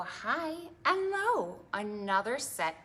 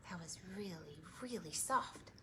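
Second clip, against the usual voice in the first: whisper; 3 semitones lower, 11 dB quieter than usual. Real, not acted. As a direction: still whispering. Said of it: "whispered follow-up".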